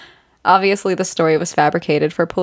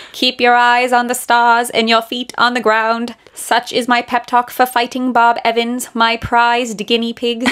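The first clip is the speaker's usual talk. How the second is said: put-on higher, old Hollywood mid-Atlantic voice; mid-Atlantic accent; 4 semitones higher than usual. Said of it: "mid-Atlantic accent".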